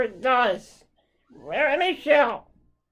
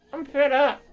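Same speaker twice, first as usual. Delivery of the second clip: sudden angry outburst, louder; fed up; 3 semitones higher, 5 dB louder than usual. Real, not acted. Fan ranting at the NHL, angry outburst. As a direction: snap it out, fed up and loud. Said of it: "I apologize for the way I'm acting".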